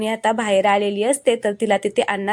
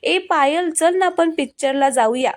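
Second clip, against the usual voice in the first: mock loud teasing; 7 semitones higher than usual. A narrator's reading. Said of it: "नाटक करत म्हणत असतो".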